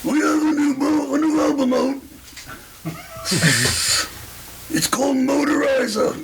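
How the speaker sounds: [name] impression. Retelling [name] at a concert.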